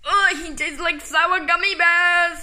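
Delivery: normal talking voice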